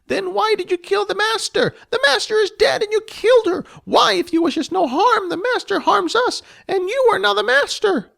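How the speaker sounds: high, loud goblin voice begging for denial; a frantic goblin who cannot believe it; pleading goblin. Acted. Gomet begs Jess to say the master lives.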